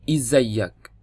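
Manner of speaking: "Izayyak" in an Egyptian Arabic accent. Shown as a demonstration. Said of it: "dalam bahasa Mesir adalah".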